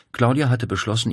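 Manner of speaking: usual speaking voice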